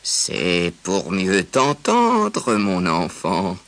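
very slow, sly big bad wolf. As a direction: stretch it out like a scheming wolf. Wolf answers the girl.

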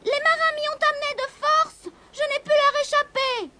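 very high, pleading kitten voice. A narrator's reading. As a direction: explain yourself in a tiny, high voice.

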